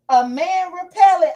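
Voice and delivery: amused high-pitched repeat; excited exclamation. Real, not acted. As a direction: call it out delighted. Exclaiming at a funny chat comment.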